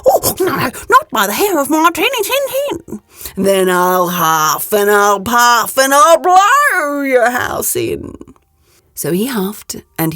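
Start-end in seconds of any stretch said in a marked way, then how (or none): 0.1-3.0 high, pleading little pig voice; a frightened piglet refusing; squeaky little pig. Acted. Little pig refusing the wolf, high and squeaky. 3.4-8.1 slow, loud, drawn-out wolf threat; big bad wolf. Wolf threatens the little pig, slow and loud.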